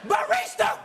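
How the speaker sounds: very high, loud yell at the counter; angry shout. Acted. Customer yelling at the barista over a latte, very high. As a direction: bellow it across the café. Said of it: "You're like".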